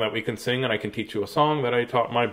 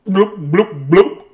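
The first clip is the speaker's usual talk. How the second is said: bubbly, higher bloop noises, like a fish; fishy bloop sounds; 4 semitones higher, 5 dB louder, slower than usual. A narrator's reading. Dog Bartholomew tries to bark, blooping like a fish. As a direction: make a goldfish blooping. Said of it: "and went".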